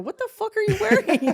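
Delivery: high, loud disbelieving shout; mock shocked yell. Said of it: "I go".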